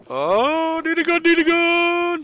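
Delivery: high frantic shout; panicked yelling. Real, not acted. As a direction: yell it in panic. Panic at landing, grabbing weapons.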